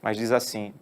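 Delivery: ordinary talk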